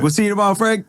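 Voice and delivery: high-pitched singled-out send-off; light, high mock call; mock farewell call. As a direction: imitate neighbors calling after someone. Acts out patio owners calling after man.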